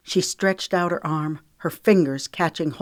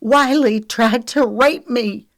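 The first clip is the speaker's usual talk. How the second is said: high, loud, slow accusation; raging; 7 semitones higher, 7 dB louder, slower than usual. A narrator's reading. Lexi tells Trent of the attack, raging. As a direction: rage it out deliberately. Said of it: "Lexi raged".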